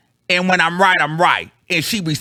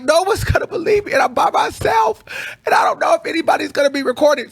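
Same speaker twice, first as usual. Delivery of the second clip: much higher, panicked shouting of "no one's gonna believe me"; frantic near-shout; 12 semitones higher, faster than usual. Real, not acted. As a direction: spill it out in a high frantic rush, almost crying. Frantic retelling of filming dilemma.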